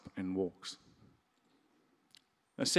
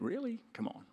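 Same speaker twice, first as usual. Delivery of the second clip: mock disbelief; 10 semitones higher, 6 dB quieter than usual. Mocking skeptics' disbelief.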